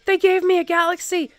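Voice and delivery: high, loud excited squeal. Retold or imitated. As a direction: gush with excitement. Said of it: "he was so excited".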